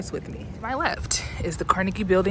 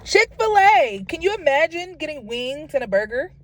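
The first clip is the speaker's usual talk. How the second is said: excited; 9 semitones higher, 7 dB louder than usual. Real, not acted. Vlogger hyping the wings and burger to viewers, loud and high. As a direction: sell it like a thrilled fan. Hyped about Chick-fil-A menu.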